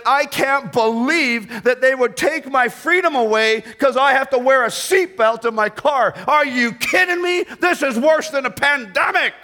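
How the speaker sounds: high-pitched, hammed-up indignant complaint; mock whining outrage. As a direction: build the mock whine into incredulous outrage. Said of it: "He didn't whine".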